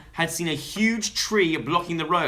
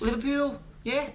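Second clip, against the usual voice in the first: put-on Scouse accent; 10 semitones higher than usual. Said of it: "What's the accent?".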